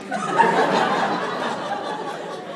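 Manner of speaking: high, loud airy laugh; big burst of laughter. Real, not acted. Laughing at the booth recording, loud and high.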